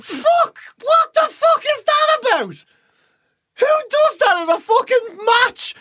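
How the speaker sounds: high, slow, incredulous outrage; outraged rant. Real, not acted. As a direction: vent in disbelief. Outraged rant about sitting rule.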